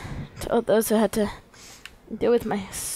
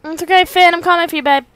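higher, louder doting voice; cooing reassurance; 9 semitones higher, 8 dB louder, faster than usual. Pretend. Player reassuring the character Finn, doting and higher. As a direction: rush in to comfort a hurt pet.

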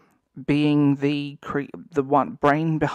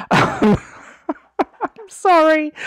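much higher, louder, slow laughing sorry; laughing apology; 13 semitones higher, 7 dB louder, slower than usual. Real, not acted. Vlogger losing composure mid-rant, laughing apology. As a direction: apologise while breaking into laughter. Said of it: "not laugh hysterically".

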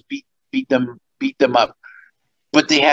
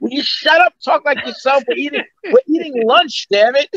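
high-pitched annoyed complaint, played for laughs; retold fed-up brothers; mock exasperation; 10 semitones higher than usual. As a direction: snap at him in mock irritation. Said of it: "So they hated me for that".

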